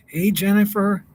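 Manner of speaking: ordinary delivery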